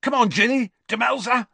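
high, excited rallying call; exuberant yell. A narrator's reading. Player hurrying two teammates to the party, exuberant yell. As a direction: call the teammates along eagerly. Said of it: "yelled Dean exuberantly".

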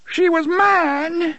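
high, slow, wailing plea through tears; a jilted little man weeping over his lost woman. Acted. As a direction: cry it out in a high broken voice. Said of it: "he wept".

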